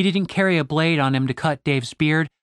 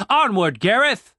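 calling out; 7 semitones higher than usual. A narrator's reading. Ordering driver onward.

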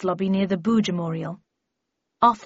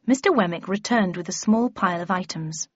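ordinary delivery, nothing marked

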